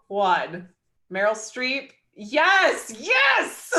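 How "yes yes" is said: high, loud, laughing yes; excited cheer. Real, not acted. Cheering that everyone married Meryl Streep, high and loud. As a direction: celebrate the unanimous vote.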